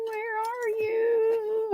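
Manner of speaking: high, slow, sing-song call; high drawn-out calling voice. Pretend. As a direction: coax it out like calling a pet. Searching for hook, calling.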